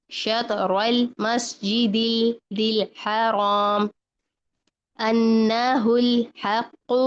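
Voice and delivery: slow, measured tajweed with drawn-out vowels; Quran recitation. Shown as a demonstration. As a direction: recite verse fragments slowly, holding each L light. Recites verses as models.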